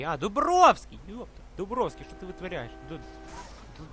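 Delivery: usual speaking voice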